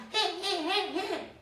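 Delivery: high, loud piglet bursts; piglets shouting. Acted. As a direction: shout in short piglet noises. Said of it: "gritaron detrás". (gritos agudos de cerditos)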